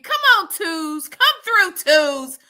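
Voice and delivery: high, loud cheer for the twos; rooting for the twos in chat. Real, not acted. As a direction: hype up the twos like a crowd.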